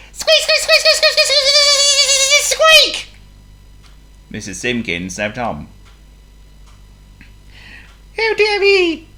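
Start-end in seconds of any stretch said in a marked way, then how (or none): 0.1-3.1 frantic squeaking. A narrator's reading. John Mouse still trying to wake his owner, frantic squeaking. 8.2-9.1 tiny mouse wail, very high and loud; dismayed squeak. A narrator's reading. John Mouse as his owner sleeps on, dismayed squeak.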